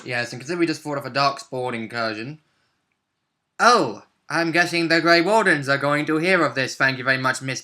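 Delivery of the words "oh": normal talking voice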